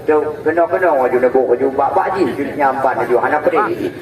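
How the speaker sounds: normal talking voice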